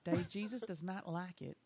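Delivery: soft, slow Southern preacher scolding; Southern Baptist voice. Pretend. Scolding Dave about curse words in a soft Southern Baptist voice. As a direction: chide gently like a Baptist churchgoer. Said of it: "my Southern Baptist voice".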